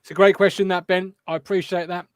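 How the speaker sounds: ordinary talk, nothing marked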